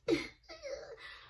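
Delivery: a high, squealing giggle; sudden high giggle mid-quote; high squealing laugh. Retold or imitated. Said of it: "she's just like".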